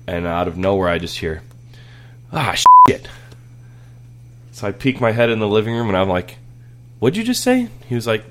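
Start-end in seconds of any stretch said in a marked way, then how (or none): 2.3-3.1 very high, loud gamer outburst; mock kid yell. Son yells at video game. 7.0-7.7 much higher, pointed challenge; stern dad. Retold or imitated. Dad confronts son.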